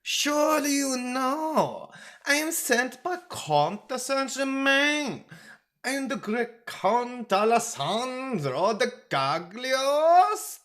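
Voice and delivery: high, grand Italian count announcing himself; drawn-out names, puffed up, loud Italian accent; boastful Italian accent. A narrator's reading. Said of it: "the traveler boasted with an aristocratically puffed bosom".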